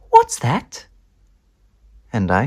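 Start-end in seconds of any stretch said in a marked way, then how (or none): 0.1-0.9 much higher, sharp question; suspicious mum. Acted. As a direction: spot the crumb. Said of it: "she says".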